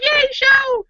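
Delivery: very high, giddy little squeal; mock cheer. Host happy just to see friends, playful cheer. Acting own happy reaction.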